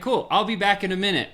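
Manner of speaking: breezy fib. Retold or imitated. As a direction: toss off the lie lightly. Customer lying to salesman.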